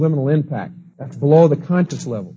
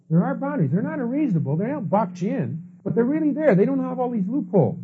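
a hint of relieved kids; 8 semitones higher, 4 dB quieter than usual. Kids discovering the boundaries.

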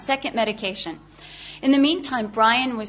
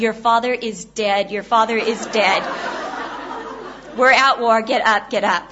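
retold screaming; 4 dB louder than usual. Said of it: "came screaming into their room".

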